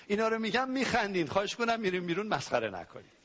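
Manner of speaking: normal talking voice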